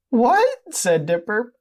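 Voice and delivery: high, loud squeaky disbelief; shocked boy. Acted. Dipper reacting to the dating news, shocked high voice.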